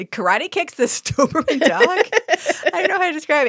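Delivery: amused, laugh breaking into the words; laughing while talking. Real, not acted. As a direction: crack up midway through the absurd description. Cracking up at absurd plot.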